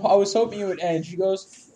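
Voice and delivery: ordinary talk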